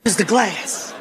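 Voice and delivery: low, cautious fearful questions; comic hushed panic; nervous dread. Retold or imitated. Acted scared tourist facing gorillas.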